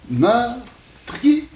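"meurtrier" pitched high, broken as meur, trier; two separate syllables. Shown as a demonstration. Teacher repeating the old count for students, "meurtrier" in two measured syllables. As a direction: hold "meur" apart, then "trier" in one syllable. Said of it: "deux syllabes".

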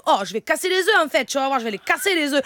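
put-on sunny southern French accent. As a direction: put on a Marseille twang. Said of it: "maintenant l'accent du Sud".

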